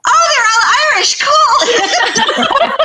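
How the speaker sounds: high, loud mock-cheerful panic; mock dismay. Author realizing the audiobook is all Irish, loud mock dismay. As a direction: shout a fake breezy cool. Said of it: "And then I'm like".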